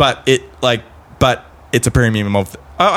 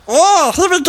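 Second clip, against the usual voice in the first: high, hammed-up mock Jamaican accent. Friend shows off his Jamaican impression, high hammed-up mock Jamaican accent. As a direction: hams up a Jamaican voice to show off. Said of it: "I got Jamaican".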